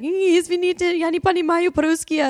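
high, loud apologetic Russian; Извините, я не понимаю, взволнованно; flustered Russian apology. Retold or imitated. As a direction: plead in Russian to a stranger yelling. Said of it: "you just look at them and be like".

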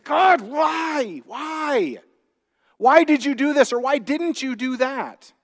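high pleading why, pitched well up; angry at God. Retold or imitated. Grieving person crying out to God, high and pained. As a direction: cry out to God in anguished frustration. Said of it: "you get angry with God".

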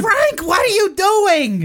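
high, drawn-out pretend scolding; hammed-up holler across the house; mock nagging spouse. Wife calls out to Frank, mock scolding yell.

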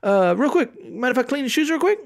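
high, bright salesman call; mall kiosk seller. Retold or imitated. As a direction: hail a passer-by like a kiosk seller. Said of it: "shoe cleaning kiosk guys is like".

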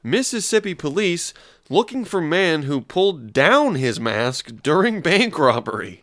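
slow, swooping newsreader delivery; slow news read. Acted. Host reading Slow News Day headline to listeners, drawn-out. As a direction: read it out very slowly, pitch rising and dipping.

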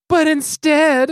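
slow, high, loud "But instead"; dramatic twist. Retold or imitated. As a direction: drag out the plot twist grandly. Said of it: "Haley hops in and goes".

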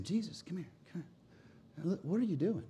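soft, discreet aside; mild suggestion. Acted. Peter pulling Jesus aside, mild and hushed. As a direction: take him aside and gently question him. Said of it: "This was not some mild suggestion".

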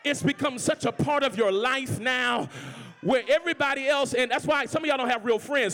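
loud sermon delivery; preaching. Acted. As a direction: drive it home to the crowd. Preaching resumes.

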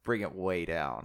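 low, soft director's note; calm it right down, low and hushed; quiet, low-pitched instruction; director giving a note. Retold or imitated. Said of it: "the director was like".

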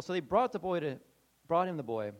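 normal talking voice

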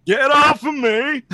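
angry shout. Acted. Vulture shouting.

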